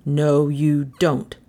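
slow, low refusal; firm policeman. A narrator's reading. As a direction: forbid it slowly, pitched low. Policeman seizes the mask.

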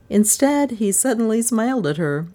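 usual speaking voice

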